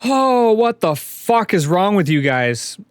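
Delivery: loud, high, disbelieving cry; startled loud shout of disbelief; shocked outburst. Real, not acted. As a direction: blurt it out, stunned by the players. GM reacts in disbelief.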